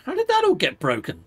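exasperated disbelief. Real, not acted. Finds his tech broken.

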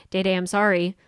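ordinary delivery

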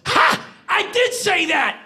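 high, explosive delighted exclamation. Retold or imitated. Singer recognising his own lyric, high delighted outburst. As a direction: burst out high with a sharp "Ha!". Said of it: "And [name] goes".

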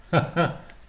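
short nervous blurted laugh. Acted. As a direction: laugh it off in unease. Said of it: "Rose blurted out a nervous laugh".

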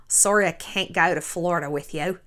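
put-on soft, wobbly try at an Australian accent; low, half-successful Australian accent; attempted Australian accent. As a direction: imitate an Australian accent, not quite landing it. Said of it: "I still sound like I had a stroke a little".

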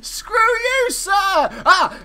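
high, loud indignant outburst; indignant yell. Real, not acted. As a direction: blurt the insult in outrage. Cursing an opponent.

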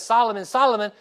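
loud, high call of a name; God calling the sleeping boy, loud and high. Acted. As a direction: call the boy's name across the dark. God calling a third time.

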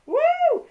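loud, high "woo" of delight; real overjoyed holler; excited whoop. Real, not acted. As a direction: let out a sudden piercing whoop. Overwhelmed by gifts in a parcel.

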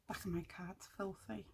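hushed whisper to herself. Real, not acted. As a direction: breathe it out under your breath. Muttering to herself while crafting.

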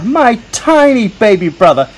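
ordinary delivery, nothing marked

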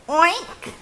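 high, squeaky oink. A narrator's reading. Cats pretend to be the hog, oinking high. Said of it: "said the cats".

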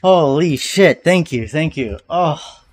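normal talking voice

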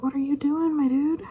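high-pitched mock disbelief, rising at the end; incredulous. To the possum munching in the bag, high incredulous question. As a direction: question it in exaggerated, high bewilderment.

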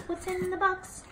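high playful sing-song quote. Pretend. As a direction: pitch it up like a kids' show host. Kids' show line sung out.

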